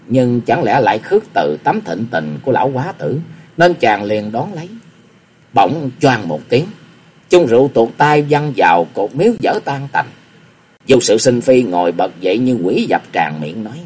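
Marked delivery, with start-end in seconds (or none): none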